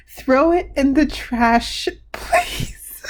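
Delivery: slow, high, breaking on the last word; holding back laughter. Real, not acted. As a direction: strain to finish before laughter takes over. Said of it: "I've never laughed that hard".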